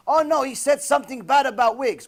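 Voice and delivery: sarcastic imitation of a complaining rabbi; mock complaint. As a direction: parody the rabbi's fuss, hammed-up. Mocking the objecting rabbi.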